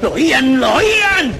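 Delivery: high frantic cry building to a shout on the last lo oían; panicked outburst. Acted. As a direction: terror breaking through the narration. Narrador en pánico creciente. (grito de pánico creciente)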